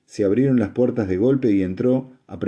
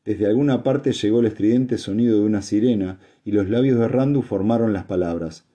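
usual speaking voice

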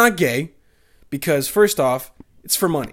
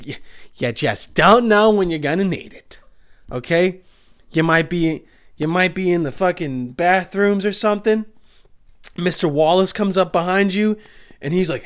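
ordinary talk, nothing marked

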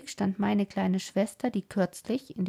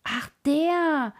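a sudden, louder, higher "Ach, der!"; surprised recognition; 6 semitones higher, 5 dB louder than usual. Acted. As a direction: it suddenly clicks who he means. (plötzlich erkennend, überrascht)

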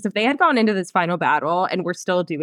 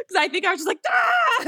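high shrieking cry; retold shocked shriek, much higher; 11 semitones higher than usual. Retold shriek reacting to book.

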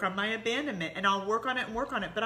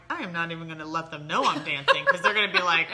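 laughing; 3 semitones lower, 8 dB louder than usual. Retold or imitated. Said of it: "where I'm like".

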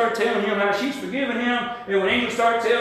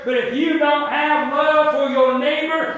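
louder, pressing sermon voice; fervent preaching; 4 semitones higher, 4 dB louder than usual. Real, not acted. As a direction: drive it home to the church. Preaching builds again.

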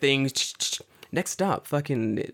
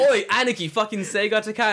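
put-on loud kid call; 10 semitones higher, 5 dB louder than usual. Kid calling him big bro.

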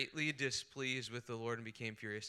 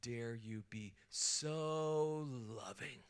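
slow, disbelieving mock outrage; acted slow indignation; indignant Jonah; 3 dB quieter, much slower than usual. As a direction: drag it out, stunned and offended. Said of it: "Jonah is furious at God's grace".

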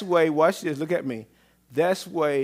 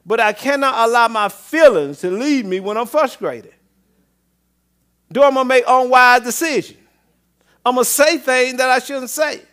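loud, raised voice driving the point home; forceful preaching; 9 semitones higher, 9 dB louder than usual. Real, not acted. Preacher telling the congregation to listen, loud and forceful.

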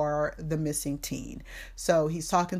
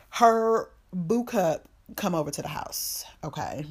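normal talking voice

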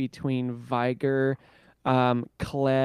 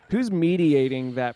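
ordinary talk, nothing marked

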